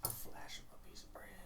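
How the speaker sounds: unvoiced sneaky whisper; hushed scheming aside. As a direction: say it under your breath, conspiring.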